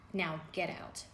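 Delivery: usual speaking voice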